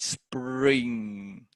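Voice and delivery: SPRING drawn out long; lengthened syllable. Shown as a demonstration. Teacher showing consonant clusters take longer, word drawn out. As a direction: stretch "spring" over a longer time, holding its ending. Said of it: "should have a longer time value".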